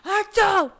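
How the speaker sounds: loud high sneeze sound; imitated sneeze. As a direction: blurt it like a sneeze.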